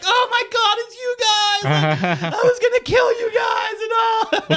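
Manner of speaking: very high, overexcited talking-dog voice; relieved dog's voice. Retold or imitated. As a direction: squeal it like an embarrassed dog greeting friends. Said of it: "He's just like".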